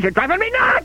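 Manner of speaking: very high-pitched comic exasperation; exasperated outburst. Acted. Host fed up with his sidekick, high exasperated outburst. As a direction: crack into a frantic high complaint.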